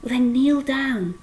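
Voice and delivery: plain reading voice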